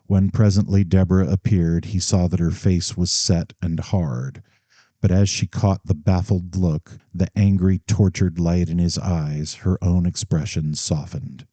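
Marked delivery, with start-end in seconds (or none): none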